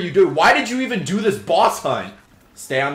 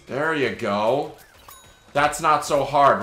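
ordinary talk, nothing marked

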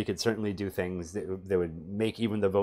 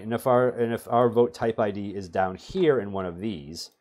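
ordinary talk, nothing marked

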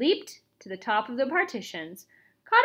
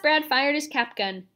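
ordinary delivery

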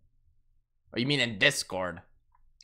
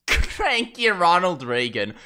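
slow, drawn-out mock announcer echo; mimicking the clip; 3 semitones higher, 4 dB louder, slower than usual. Streamer laughing and parroting the pronunciation video, mimicking. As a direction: parrot it slowly, dragging out the first word.

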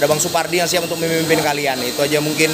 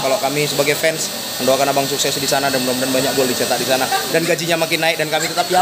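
ordinary talk, nothing marked

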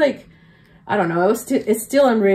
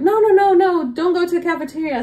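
quickly head her off, alarmed; urgent no; 9 semitones higher than usual. Retold or imitated. Coworkers stopping her from the cafeteria, higher and urgent. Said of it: "they were like".

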